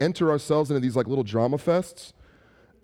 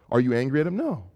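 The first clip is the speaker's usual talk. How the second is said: normal talking voice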